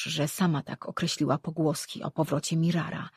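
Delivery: plain reading voice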